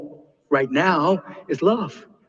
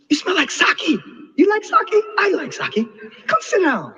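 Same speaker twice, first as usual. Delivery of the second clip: put-on cheerful old man; 13 semitones higher than usual. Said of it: "He said".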